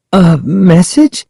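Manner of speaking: high, slow, hesitant question; stammering. A narrator's reading. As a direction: falter on it, rising in surprise. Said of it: "Sari stammered".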